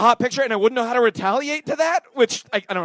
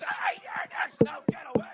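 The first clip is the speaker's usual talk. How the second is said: high, voiceless mock shriek, kept soft; hammed-up horror creature; 7 dB quieter than usual. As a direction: squeal it like a tiny movie monster. Acts out menacing horror-film intruder.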